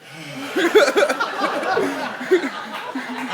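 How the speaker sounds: big exaggerated sigh of relief, high and loud. Passenger relieved he passed by, mock sigh. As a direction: let out a hammed-up relieved sigh. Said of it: "You gotta hear the sigh of relief".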